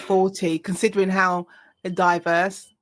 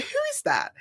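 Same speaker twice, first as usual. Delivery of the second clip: shocked disbelief; 5 dB quieter than usual. Retold or imitated. Lawyer hearing her own recording, high startled disbelief. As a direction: pitch jumps way up, breathy and softer. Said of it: "I was like".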